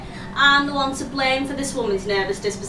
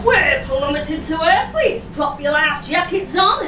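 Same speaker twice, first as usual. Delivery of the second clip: raised, hammed-up Yorkshire announcement; broad Yorkshire accent, pitched up; 4 semitones higher than usual. Shown as a demonstration. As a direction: warn passengers in broad Yorkshire. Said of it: "in a Yorkshire accent".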